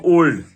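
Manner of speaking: teacher's model ओल्ड, round long "o"; long o sound. Shown as a demonstration. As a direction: lean on the "o" of ओल्ड. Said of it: "ओ की ध्वनि में".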